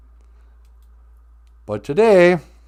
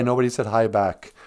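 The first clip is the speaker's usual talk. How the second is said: usual speaking voice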